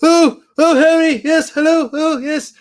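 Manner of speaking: put-on very high, loud jolly old professor; sky-high delighted old professor voice; Slughorn impression. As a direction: gush like a jolly, proper old teacher. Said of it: "he's very, like, proper and he's very, like, jolly".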